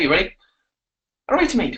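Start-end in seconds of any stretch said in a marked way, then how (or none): 1.3-1.8 high-pitched put-on Irish; Irish accent. Shown as a demonstration. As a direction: open brightly in mock Irish. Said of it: "My turn, okay?".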